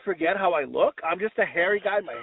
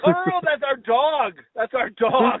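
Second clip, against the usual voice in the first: flustered backpedal; 6 semitones higher, 3 dB louder, much slower than usual. Retold or imitated. Panicked correction to store clerk.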